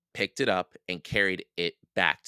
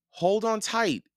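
high-pitched urgent call; urgent urging; 4 dB louder than usual. A narrator's reading. Jack to Annie as wildebeests approach, urgent.

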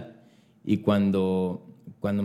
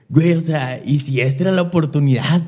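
raised, louder frustrated self-question; frustrated outburst; 5 semitones higher, 5 dB louder than usual. Retold or imitated. Kicking himself over the missed casting, frustrated and loud. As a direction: blurt out the worry that the chance is gone.

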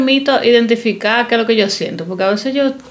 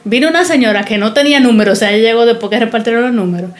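ordinary delivery

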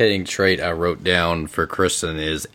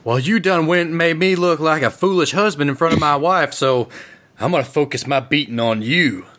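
angry husband; 9 semitones higher than usual. Acted. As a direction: threaten him like a humiliated thug. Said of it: "he clenches the glass bottle".

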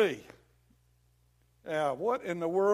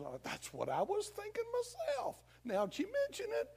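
devil feigning agreement; 14 semitones higher, 4 dB quieter than usual. Acted. Devil agreeing with a doubting believer, high mock-innocent voice. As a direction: act as if it just dawned on you, way up high. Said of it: "Devil says".

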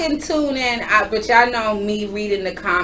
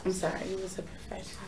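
fast breathy whisper; 4 semitones lower, 19 dB quieter, faster than usual. Real, not acted. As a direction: tease her under your breath. Whispered teasing aside.

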